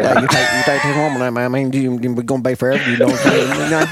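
rambling Southern mumble, words slurred together; Boomhauer. Acted. Host spoofing the justice of the peace marrying the couple, rambling mumble. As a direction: babble the vows like a garbled Texan. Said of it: "he is Boomhauer from King of the Hill".

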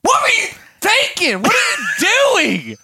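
high-pitched hammed-up yell; mock outraged scolding. Dad scolding daughter over fire alarm, comic outrage. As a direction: imitate a dad losing it, voice shooting up.

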